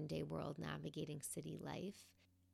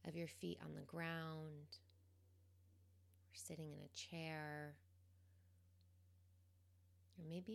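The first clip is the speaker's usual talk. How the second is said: calm, quiet guidance, leaving space between phrases; guided meditation; 8 dB quieter than usual. Real, not acted. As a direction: gently guide them into the present moment, unhurried.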